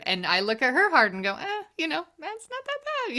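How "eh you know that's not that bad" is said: high-pitched, offhand retold verdict; light mock dismissal; dismissive shrug. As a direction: wave it off in a much higher voice. Retold shrug at another's hardship.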